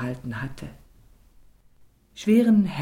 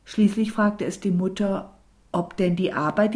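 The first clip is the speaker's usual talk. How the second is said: ordinary delivery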